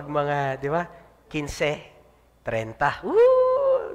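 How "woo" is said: a high, loud whoop of joy; payday cheer. Pretend. (masayang sigaw ng sweldo)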